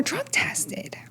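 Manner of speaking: hushed, high whisper; whispered aside. Real, not acted. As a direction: drop to a breathy aside. Telling of the drug tests.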